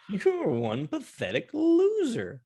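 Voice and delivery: high, drawn-out mock insult; mocking taunt. Friend ribbing friend about waiting till October, mocking taunt. Teasing friend after calling him loser.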